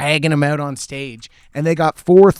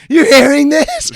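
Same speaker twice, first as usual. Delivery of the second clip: high, loud, slow "You hearing this?"; mock excited shout at a friend; excited yell; 11 semitones higher, 7 dB louder than usual. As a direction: yell it in a friend's face, delighted. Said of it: "being like".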